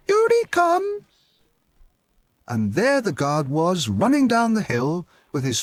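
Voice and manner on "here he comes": put-on much higher excited child shout; boy shouting. A little boy shouting to everyone, high excited shout. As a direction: call out like a little boy spotting him. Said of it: "a little boy shouted".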